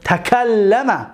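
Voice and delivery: تکلم drawn out: ta-ka-lla-ma; slow dictation; slow model word. Shown as a demonstration. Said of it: "یک تا درس گذشته گرفته بودیم".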